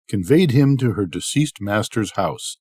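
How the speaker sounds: usual speaking voice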